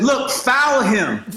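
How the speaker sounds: high, slow, deliberate command; slow pointed order. Retold or imitated. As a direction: spell it out for the teammate, slowly. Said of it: "and I said".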